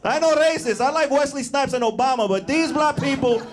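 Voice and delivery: hammed-up Spanish-accented mom. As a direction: lecture like an offended abuela. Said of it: "she's like".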